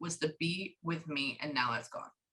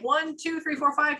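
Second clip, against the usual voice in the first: high playful counting; 10 semitones higher than usual. Retold or imitated. Counting to spirit.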